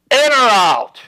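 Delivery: high-pitched, booming imitation of Dad; booming father's voice. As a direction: call it out like a father's booming demand. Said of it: "my father's booming voice that said".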